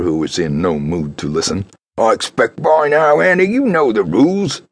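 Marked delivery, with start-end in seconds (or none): 2.0-4.6 higher, curt lodging keeper; blunt keeper. Acted. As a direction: lay down the rules flatly. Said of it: "he told her bluntly".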